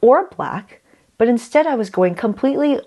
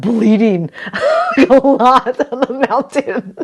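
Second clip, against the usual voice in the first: louder and higher, laughing through the words; 7 semitones higher, 5 dB louder than usual. Real, not acted. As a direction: laugh at your own mishap. Laughing at her bleeding finger.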